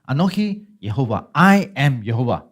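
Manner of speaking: God declaring his name with emphasis; emphatic divine declaration. Acted. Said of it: "God is emphatically saying".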